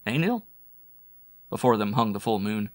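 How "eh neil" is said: high rising question; quick upward nudge for agreement; prompting query. A narrator's reading. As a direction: lilt it up to ask a comrade. Sukune turns to Neil asking.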